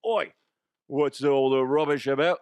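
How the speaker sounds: loud, hammed-up Cockney; Cockney accent. Host having another go at the accent, put-on Cockney. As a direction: bark it in mock Cockney. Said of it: "my Cockney accent needs some work".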